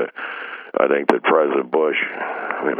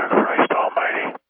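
exasperated whisper; slower than usual. Real, not acted.

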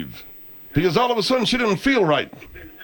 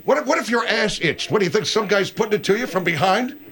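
ordinary delivery

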